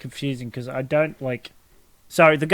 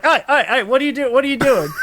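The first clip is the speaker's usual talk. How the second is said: high, laughing imitation of alarmed kid; hammed-up startled objection; alarmed protest; 9 semitones higher, 3 dB louder than usual. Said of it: "And Eric's like".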